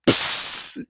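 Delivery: loud, sharp hissed sound effect; voiceless hissing noise; imitated noise. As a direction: make a short burst of hiss. Imitated noise in retold scene.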